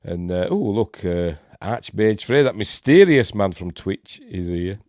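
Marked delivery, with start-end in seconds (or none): none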